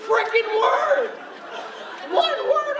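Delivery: high, loud, slow laughing outburst; exasperated shout. Real, not acted. Storyteller fuming about costar's one word, loud. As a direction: shout it in mock disbelief.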